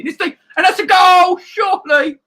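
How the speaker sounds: high, loud shout of a goal; excited goal shout. Real, not acted. Streamer shouting at a near goal on screen, excited yell. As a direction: yell it like the ball is going in.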